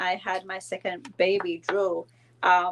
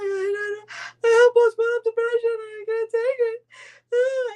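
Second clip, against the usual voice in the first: high, slow, breaking into sobs; retold crying; 4 dB louder than usual. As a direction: act out your teary confession. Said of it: "I'm like".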